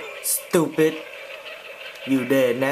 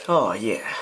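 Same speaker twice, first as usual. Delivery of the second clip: suggestive; 6 semitones lower than usual. Acted. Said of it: "That sounded wrong".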